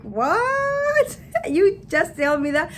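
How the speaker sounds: very high, stretched-out shocked question; mock disbelief. Reacting to seller sending her elsewhere, mock disbelief. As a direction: play up the astonishment, voice shooting up.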